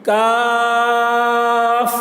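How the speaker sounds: کاف with a light front k, held long; stretched thin Kaf. Shown as a demonstration. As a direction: chant a thin KAAAF, air on the final f. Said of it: "آخر میں ہوا نکل رہی ہے".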